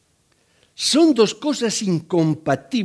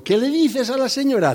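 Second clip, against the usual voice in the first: prompting mother; 8 semitones higher than usual. Retold or imitated. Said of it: "la mamá le dijo".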